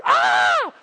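loud terrified yell; panicked scream. Retold or imitated. Servant screaming in panic at the surrounding army. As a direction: let out a frightened scream. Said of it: "Servant's like".

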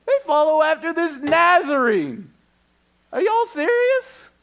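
high, scornful mock disbelief. Accuser mocking the followers of the Nazarene, high and scornful. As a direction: sneer it like the accuser would.

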